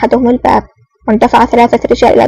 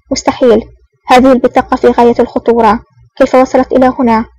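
ordinary delivery

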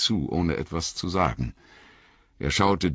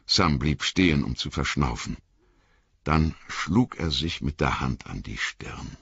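plain reading voice

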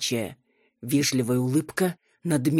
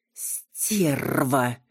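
hissing feral vampire. Acted. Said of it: "прошипел вампир".

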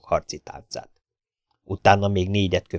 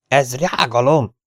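slow, higher cry of injured pride; offended cry; 5 semitones higher, 4 dB louder, slower than usual. A narrator's reading. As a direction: protest slowly, deeply offended. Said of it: "kiáltotta sértődötten Ahmed". (sértődött, elnyújtott kiáltás)

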